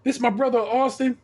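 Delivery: imitating an upbeat young neighbor introducing himself; friendly neighbor. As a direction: greet him brightly like a friendly guy. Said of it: "the guy's like".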